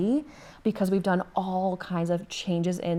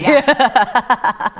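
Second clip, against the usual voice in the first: laughing; 5 semitones higher, 10 dB louder than usual. Real, not acted. Laughing at the accent joke.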